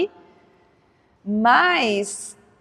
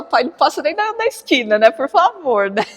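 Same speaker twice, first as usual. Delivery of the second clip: put-on pleading teen; 5 semitones higher, 3 dB louder than usual. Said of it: "Quando tá adolescente, fala".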